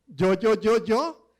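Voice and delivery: acting out Moses stuck on his words; stuttering Moses. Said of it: "Moisés era de esos".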